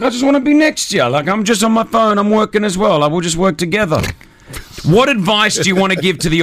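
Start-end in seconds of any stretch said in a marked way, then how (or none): 0.0-4.0 higher-pitched, quick retold pleading; retold plea. Telling her he wants to work together, high retold plea. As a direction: imitate yourself pleading, pitched up and hurried. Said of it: "I'm like".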